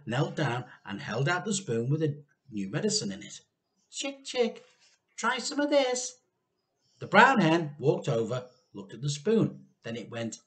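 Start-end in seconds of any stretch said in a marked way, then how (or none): 3.9-6.1 coaxing call. A narrator's reading. George tempting the chicken, high coaxing call. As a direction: sweet-talk the bird to the spoon.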